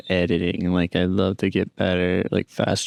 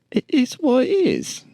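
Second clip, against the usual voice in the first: "it is what it is" repeated much higher, in a squeaky disbelieving tourist voice; incredulous tourist. Acted. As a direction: echo the guide in a high, baffled voice. Tourist echoing the guide.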